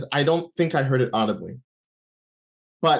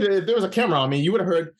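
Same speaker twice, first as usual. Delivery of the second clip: usual speaking voice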